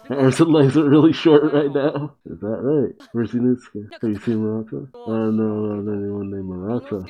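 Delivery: usual speaking voice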